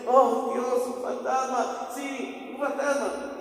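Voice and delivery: high-pitched imitation of scared men; acted alarm. Disciples hide from the ghost, acted frightened cry. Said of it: "Se escondieron".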